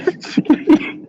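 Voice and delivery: airy burst of laughter; breathy high laugh. Real, not acted. Laughs at own joke.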